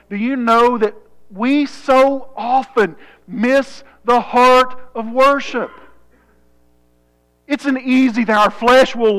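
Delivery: forceful preaching. Real, not acted. Preacher warns his church about missing worship, forceful preaching. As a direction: drive the point home to the congregation.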